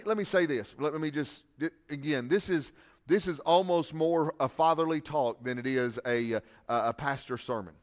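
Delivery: ordinary talk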